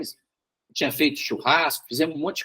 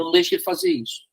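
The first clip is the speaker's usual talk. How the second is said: lightly voiced inner warning, higher and quick; quick, high-pitched retold warning; Holy Spirit's warning; 10 semitones higher, faster than usual. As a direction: retell an urgent prompting, pitched up and hurried. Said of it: "o Espírito Santo falou comigo".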